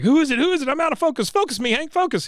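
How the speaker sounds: high, frantic squeaky voice; frantic Dale. Retold or imitated. Dale begs Hank to focus his binoculars: high frantic squeal. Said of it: "And Dale's like".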